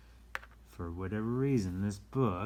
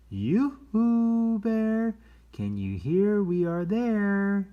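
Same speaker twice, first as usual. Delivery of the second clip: high, loud sing-song call of yoo-hoo; high, loud playful yoo-hoo to a hidden bear; 12 semitones higher, 8 dB louder, much slower than usual. Acted. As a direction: call across the woods in a high voice. Repeating the call, fuller.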